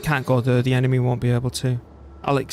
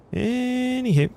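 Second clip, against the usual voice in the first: sing-song anywho; 11 semitones higher, much slower than usual. Real, not acted. Moving on from the history chat.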